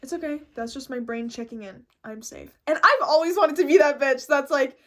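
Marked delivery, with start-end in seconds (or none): 0.0-2.6 softening, settling self-talk; acted calm, easing down quieter; calm self-reassurance. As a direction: reassure yourself, voice dropping softer. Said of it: "I'm gonna be like".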